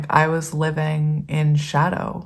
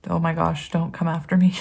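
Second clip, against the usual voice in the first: ordinary delivery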